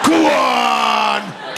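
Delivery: high, shouted goading cry; mock-lairy urging yell; egging on. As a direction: shout it like a rowdy crowd. Mimics crowd egging him on.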